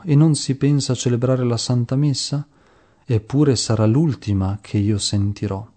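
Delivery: usual speaking voice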